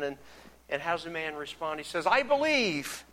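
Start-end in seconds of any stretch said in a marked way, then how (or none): 2.1-3.0 high-pitched, drawn-out avowal of belief; emphatic declaration. Acted. Desperate father answers Jesus, emphatic declaration. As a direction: declare it eagerly, pitch leaping up then falling.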